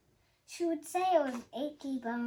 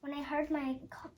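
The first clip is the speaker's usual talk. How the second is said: usual speaking voice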